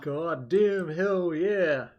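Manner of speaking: slow, drawn-out, high hype yell; American impression. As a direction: stretch out a cocky American cheer. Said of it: "your best American impersonation".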